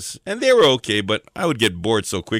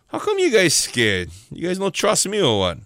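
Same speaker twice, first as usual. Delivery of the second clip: high-pitched, incredulous local-style asking; chiding pidgin question; 7 semitones higher than usual. Acted. Jesus asking his scared guys why, high chiding pidgin. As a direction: gently tease them for not trusting.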